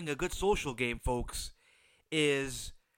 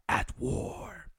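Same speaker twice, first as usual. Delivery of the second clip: dramatic whisper; 2 semitones higher than usual. Acted. Dramatic whispered show title.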